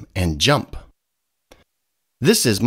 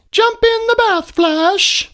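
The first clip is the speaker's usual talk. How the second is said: 1 mother voice; 4 dB louder than usual. A narrator's reading. Mother dolphin calls Flash.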